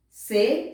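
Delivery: "Ser" said slowly as "sê", without any R; R dropped. Shown as a demonstration. Teacher explaining the wrong version, R dropped. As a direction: leave "Ser" open on the vowel, R silent. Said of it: "pronúncia correta do R".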